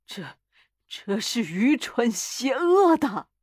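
faltering start, then slow quavering protest; stammer, then drag the words out fearfully; shaken, slow objection; trembling voice. A narrator's reading. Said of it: "他带着发颤的嗓音说".

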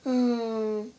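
long, low "hmm"; resigned hummed sigh. A narrator's reading. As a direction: let out a defeated little hum. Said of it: "looked at the ground and sighed".

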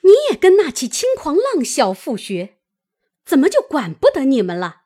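fast, loud, high-pitched tirade; angry cursing. A narrator's reading. Mother slaps her daughter and curses her, fast and furious. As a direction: berate her without letting up. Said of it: "骂道".

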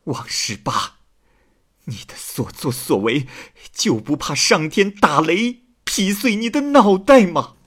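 accusing outcry, rising to a high pitch; clamouring wife. A narrator's reading. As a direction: scold him, building into a high shout. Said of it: "只听见王妻嚷嚷道". (妻子嚷嚷着斥责，越喊越高)

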